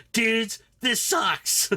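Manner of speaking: a drawn-out wail of mock panic; freaking out. Engineer Drift losing his nerve, mock panicked whine. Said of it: "He's freaking out".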